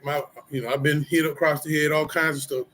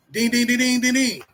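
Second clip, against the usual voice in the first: mock bell dings; 7 semitones higher, 3 dB louder than usual. Mock bell after callout.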